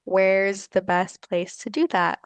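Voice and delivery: ordinary delivery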